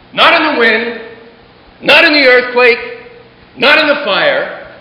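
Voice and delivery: preacher's rising repetition; rising emphasis. A narrator's reading. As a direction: build it like a preacher's crescendo. Building rhetorical repetition.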